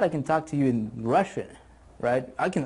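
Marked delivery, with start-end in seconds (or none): none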